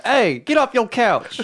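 high, slow hammed-up TV ad pitch; mock the late-night school commercial.

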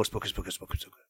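low, hushed spooky chant; spooky ghost whisper. Acted. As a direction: hush it like a ghost.

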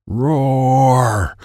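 imitated tiger roar. A narrator's reading. Narrator voices a tiger nearby, mock roar. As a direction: roar the word out, drawn long.

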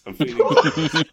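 laughing. Real, not acted. Host gloating, big laugh.

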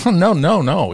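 high, light "no, no, no"; mock protest. Radio owner correcting the boy, high mock protest. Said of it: "And he goes".

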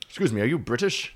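lightly put-on British accent; a touch of hammed-up British; mock British accent. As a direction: imitate a polite stranger in a British accent. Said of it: "Was he British?".

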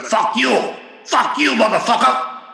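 high, furious mock yelling; cursing scam caller. Scammer cursing at the prank victim, furious yell. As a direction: imitate the caller exploding with curses. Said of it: "he started cursing at me".

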